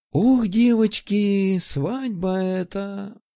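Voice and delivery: slow, high, breathless swoon; dreamy sigh. Acted. Girl swooning over the wedding.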